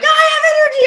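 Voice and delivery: high, loud excited yell. Retold or imitated. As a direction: shout it with wild energy. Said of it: "I'm, like, yelling".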